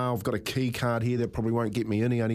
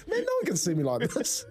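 high, laughing retold thought; 12 semitones higher than usual. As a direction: squeal it out through laughter. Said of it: "I was like".

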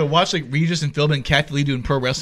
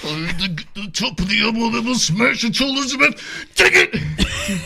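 wrestler promo voice; 6 semitones higher, slower than usual. Retold or imitated.

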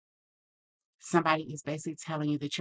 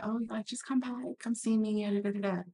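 imitating mom's casual invite; 6 semitones higher, 4 dB quieter than usual. Mother casually inviting her over.

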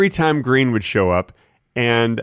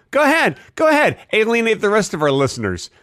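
indignant dare; 8 semitones higher than usual. Acted.

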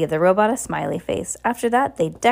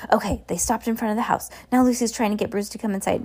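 plain reading voice

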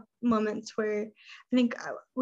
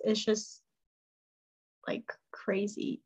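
whisper; 6 dB quieter, slower than usual. Real, not acted. Whispered aside about success.

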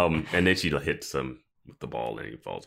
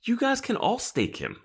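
exasperated. Retold or imitated. Exasperated thought at the characters.

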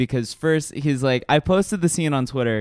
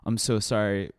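hushed, lower mock-somber voice; super somber; 4 semitones lower, 6 dB quieter than usual. Friend reacting to cancer news, acted super somber. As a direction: drop low and soft, acting sorry. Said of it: "gets, like, super somber".

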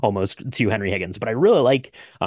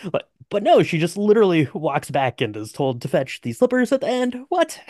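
exasperated; 7 semitones higher than usual. Real, not acted. Podcaster ranting to cohost about the ending, outraged and high. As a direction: pile on the outrage and peak on what. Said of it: "ranting at film ending".

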